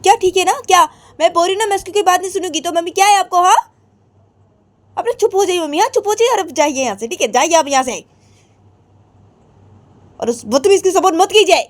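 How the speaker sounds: high, loud and rushed; angry outburst. Acted. Radhika telling her mother to leave, angry high outburst. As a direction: tell mom off, fast and furious.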